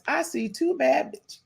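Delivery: lightly voiced rap line. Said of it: "in my [name] voice".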